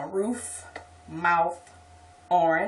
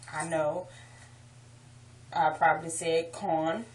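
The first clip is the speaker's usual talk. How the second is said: normal talking voice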